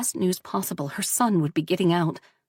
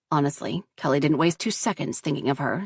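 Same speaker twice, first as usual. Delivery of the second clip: usual speaking voice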